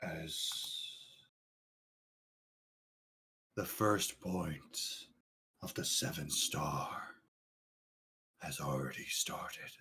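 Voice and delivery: low, hushed, mysterious narration; ominous whisper. Acted. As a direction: whisper it like a prophecy from the void.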